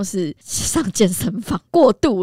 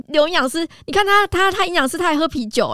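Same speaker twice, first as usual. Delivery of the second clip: teasing accusation; 9 semitones higher, 5 dB louder than usual. Retold or imitated. Friends teasing the nutritionist.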